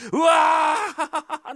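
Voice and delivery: drawn-out cry of shock; shocked yell. Acted. Fake doctor yells in shock at the fart, a long high cry.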